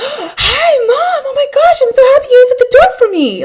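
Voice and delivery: put-on high, gushing little-girl voice with a gasp; excited little daughter. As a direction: play the thrilled five-year-old greeting mom at the door. Mom imitates excited daughter.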